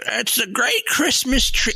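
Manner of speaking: put-on high, slow creaky old man; old timer voice. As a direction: drag it out like a frail old-timer. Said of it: "his old timer voice".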